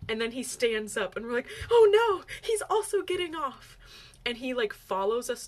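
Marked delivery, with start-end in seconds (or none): 1.7-3.8 high, loud panicked outburst; dramatized dismay, bursting out high and loud; mock panic. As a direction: retell it as an alarmed cry, pitched way up. Panicked reaction retold in story.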